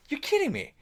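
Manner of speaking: very high retold disbelief; incredulous. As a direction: react in high-pitched astonishment.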